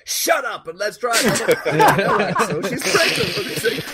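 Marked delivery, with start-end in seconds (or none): 0.0-1.2 high-pitched mock yell; Axel. Game driver dismissing his complaining passenger, hammed-up impatient high voice. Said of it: "And Axel goes". 2.0-3.3 high, laughing appeal; mock protest. Player telling the driver his passenger is pregnant, mock-exasperated high voice.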